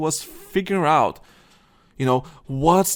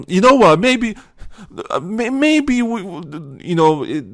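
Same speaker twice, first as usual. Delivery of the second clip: much higher and louder hammed-up pundit voice; mock right-wing Captain America; 4 semitones higher, 4 dB louder than usual. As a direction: play a blustering right-wing guy, half-hesitant. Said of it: "person's like".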